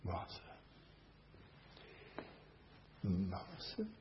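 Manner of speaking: soft, loving repeat of the name; tender call. Shown as a demonstration. Preacher voicing Jesus gently calling Martha, softly. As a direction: say it like holding a child close. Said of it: "is this not what is happening here?".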